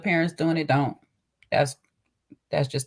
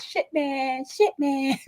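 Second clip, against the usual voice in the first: little cousin copying her mom, pitched high; high little-girl mimic; 10 semitones higher, much slower than usual. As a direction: parrot it like a small child. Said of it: "So she running around the house".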